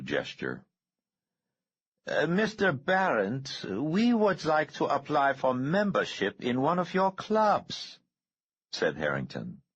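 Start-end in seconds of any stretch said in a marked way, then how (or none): 2.1-8.0 a creepy high-pitched killer, savoring it; eerie high villain voice. A narrator's reading. Harrington's voice.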